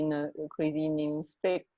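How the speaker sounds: ordinary talk, nothing marked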